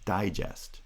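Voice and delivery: front-stressed digest with a full second vowel; stress on first syllable. Shown as a demonstration. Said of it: "is higher than the pitch of the second syllable".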